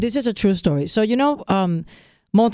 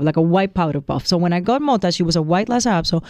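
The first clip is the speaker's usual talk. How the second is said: ordinary delivery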